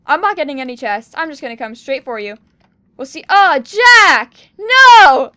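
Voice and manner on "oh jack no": much higher, very loud cry of dismay; anguished shout. Real, not acted. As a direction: wail as the fight is lost. Losing the fight to Jack.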